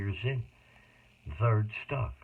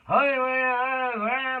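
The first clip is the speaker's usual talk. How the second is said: sudden outburst; 13 semitones higher, 8 dB louder, much slower than usual. Real, not acted. Sudden outburst mid-story.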